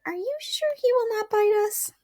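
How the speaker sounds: timid voice. A narrator's reading. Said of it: "asked in a timid voice".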